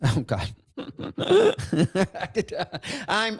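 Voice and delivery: usual speaking voice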